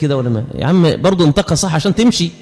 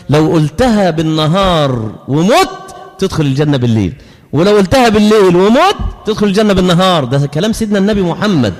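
usual speaking voice

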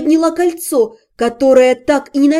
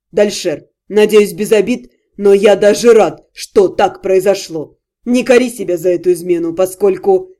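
a hint of a self-satisfied rival; lightly voiced smug suitor; 6 semitones lower than usual. A narrator's reading. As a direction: lower male voice, smooth and teasing. Vashariy voiced low.

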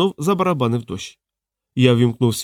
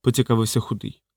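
ordinary delivery, nothing marked

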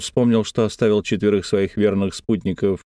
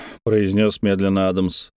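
ordinary delivery, nothing marked

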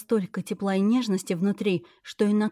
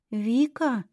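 put-on small sad child voice; 3 semitones higher than usual. A narrator's reading. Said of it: "тихо и печально ... тоненьким голоском".

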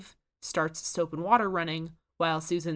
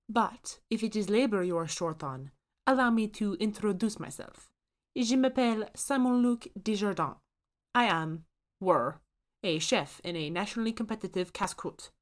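put-on thick French accent, outraged; a French chef appalled, in French accent; 4 semitones higher than usual. A narrator's reading. Ghost chef scolds Susan about potato powder, thick French accent. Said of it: "The chef's accent is thickly French".

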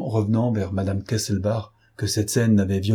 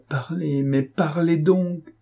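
higher, drawn-out insistent command; insistent order; 7 semitones higher, slower than usual. A narrator's reading. Police chief pressing the witness to speak, slow insistent order. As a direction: press harder, stretching each word out. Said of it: "ordonna monsieur Lenormand".

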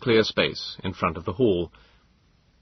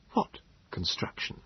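unvoiced whispered question; 10 dB quieter than usual. A narrator's reading. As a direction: whisper back in confusion. Answering the whisper.

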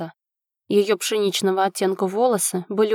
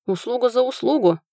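suddenly serious; 4 semitones higher than usual. A narrator's reading. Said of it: "резко посерьезнев".

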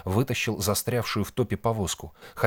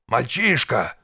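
sharp, loud rebuke; angry shout; 5 semitones higher, 5 dB louder, slower than usual. A narrator's reading. Old man cutting off reckless Nik, shouting. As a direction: shout the word down at him. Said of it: "крикнул на него Шептун".

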